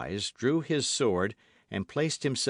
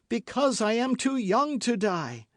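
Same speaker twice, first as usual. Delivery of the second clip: high, louder desperate plea; crying out; 13 semitones higher, 4 dB louder than usual. A narrator's reading. Milady crying out, struggling against her bonds. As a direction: beg for her life. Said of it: "cried Milady".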